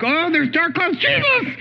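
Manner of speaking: high, alarmed call out to Jesus; mock alarm, leaping high on the name; panicked cry for help. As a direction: cry out the name sharply upward. Acts disciples crying out to Jesus.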